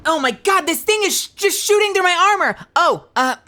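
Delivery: high, loud alarmed yelling; acted-out panic in a firefight; panicked gamer. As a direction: shout in alarm as if under fire. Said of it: "a juggle where I'll be like".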